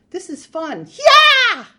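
high, loud, exhilarated outburst; gleeful karate shout. Acted. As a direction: build to a huge high-pitched battle cry. Marge gleefully smashing plates, karate noises.

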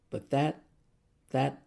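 slow, halting angry sputter; husband sputtering about the attorney. Acted. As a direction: trip over the words in rage. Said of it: "he sputtered".

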